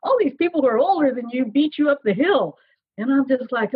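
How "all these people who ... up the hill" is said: higher-pitched imitation of a nagging child; mock child egging someone on; goading kid. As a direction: needle your grown-up like a kid. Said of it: "he's, like, encouraging me".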